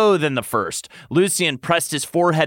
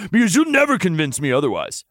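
quick, firm mock growl; 4 semitones higher, faster than usual. Lucien insisting to Tamlin, mild growl. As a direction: shut the argument down fast. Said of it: "Lucien growled".

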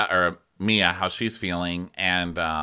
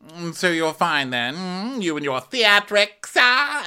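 high, drawn-out haughty taunt; mock Karen; 9 semitones higher, 3 dB louder, slower than usual. Karen sparring with Giselle by phone, mock haughty. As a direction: needle with exaggerated hums and stretched words. Acted quarrel, Karen's part.

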